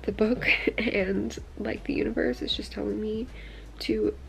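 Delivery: hushed, emotional whisper, close to tears. Real, not acted. Whispering to viewers about moving west, emotional. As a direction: confide a dream in a near-whisper.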